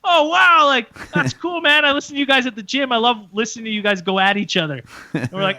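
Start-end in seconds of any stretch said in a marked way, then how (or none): 0.0-1.6 high, loud impressed "wow"; imitated starstruck delight; delighted fan. Retold fan's delighted reaction. 1.6-4.8 high, fast excited praise; imitated breezy fan enthusiasm; gushing fan. Retold fan gushing to hosts.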